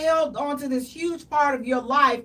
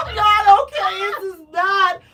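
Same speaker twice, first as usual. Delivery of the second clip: mock upset outburst; 6 semitones higher, 7 dB louder than usual.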